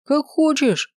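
high-pitched call from far off; distant reply. A narrator's reading. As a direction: call back as if from down the corridor. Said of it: "донеслось невнятно". (высокий выкрик издалека)

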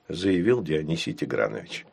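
plain reading voice

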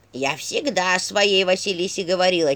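slow, emphatic talking-staff voice; Черепушка. A narrator's reading. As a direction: lay down old wisdom slowly in character. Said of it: "заметила Черепушка". (назидательный посох, медленно)

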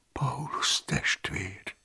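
slow, breathless whisper. Acted. The frightened cellarius whispering Paulus's name.

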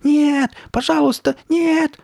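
very high, loud panicked pleading; high-pitched screams of terror; terrified wailing. A narrator's reading. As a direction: shriek and beg like a frightened little boy. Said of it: "завопил он, выпучив глаза от страха".

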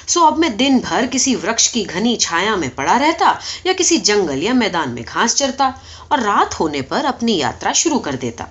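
ordinary delivery, nothing marked

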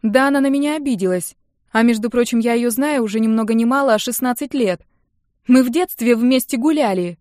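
loud, high-pitched aggrieved outburst; shouted. A narrator's reading. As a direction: yell your grievance at them. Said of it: "прокричала я".

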